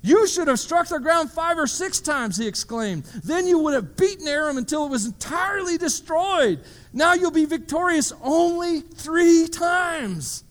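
put-on a dying old prophet's angry outburst. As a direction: scold him for quitting too soon. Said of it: "the man of God was angry with him".

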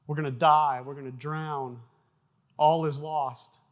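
loud retold alarm; mock panic. Disciples freaking out in another storm, mock panic. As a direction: cry out as if doomed, only half in earnest. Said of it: "They're freaking out, saying".

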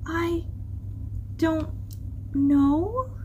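small hesitant voice with a pause between words; hushed. A narrator's reading. Humphrey fights back tears answering the crab, hushed. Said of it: "whispered Humphrey".

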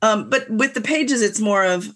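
normal talking voice

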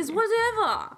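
high-pitched, hammed-up dismissal; mock dismissive. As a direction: toss it off in a high mock huff. Skit of the Grimm brothers bickering.

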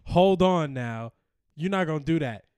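mock confrontation. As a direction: square up to a rival in a skit. Acted showdown with waiter before date.

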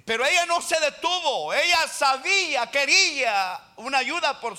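loud, high pulpit cry; forceful, emphatic proclamation; impassioned preaching. Real, not acted. Preaching her persistence at full force.

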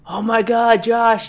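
high, loud excited hello; excited greeting. Retold or imitated. Bronco fan greets the front desk guy, loud and excited. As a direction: greet your buddy with glee.

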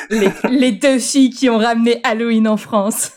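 laughing while talking; giggling through the words. Real, not acted. Joking self-praise.

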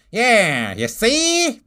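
put-on old-timey gangster "you see?"; high sneering cartoon villain; cartoon bad guy. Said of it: "he would always say".

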